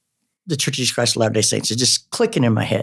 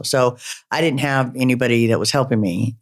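ordinary talk, nothing marked

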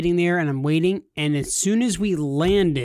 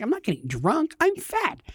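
mock indignant squeak; 12 semitones higher than usual. Defends himself to wife.

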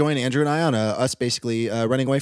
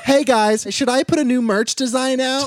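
influencer voice; 8 semitones higher, 4 dB louder than usual. Retold or imitated. Imitating influencer post.

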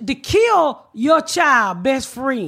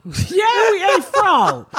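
mocking mother; 5 semitones higher, much slower than usual. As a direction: squeal it like an indignant mother. Mother voiced mocking in story.

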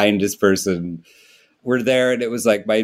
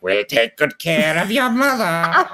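higher, slower villain voice of a care-home matron; Miss Trunchbull; 8 semitones higher than usual. Pretend. Care-home villain pretending to reassure the family, higher and slow. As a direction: sound sweet but menacing. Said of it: "there's just a Miss Trunchbull ... being like".

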